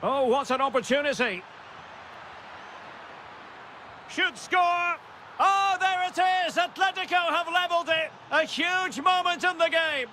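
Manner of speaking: high-pitched, building goal commentary; thrilled play-by-play at an equaliser; excited goal call. Real, not acted. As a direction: build from anticipation to a sustained high cry. Calls the equalising goal live.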